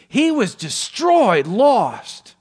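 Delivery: high, loud, drawn-out exclamation; impassioned cry. Retold or imitated. Father tells elder son of lost brother, impassioned. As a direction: cry out each word with heavy weight. Preacher acting father's emphatic climax.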